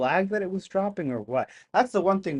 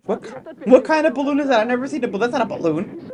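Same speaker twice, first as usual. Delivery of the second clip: higher, louder incredulous voice; lightly mock his disbelief; a hint of an exasperated man; mimicking the husband; 8 semitones higher, 5 dB louder than usual. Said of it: "He said".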